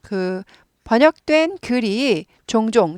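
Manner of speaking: normal talking voice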